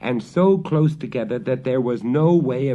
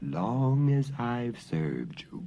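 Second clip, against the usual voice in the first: growling dog; 3 semitones higher, 6 dB quieter, slower than usual. A narrator's reading. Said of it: "growled the dog".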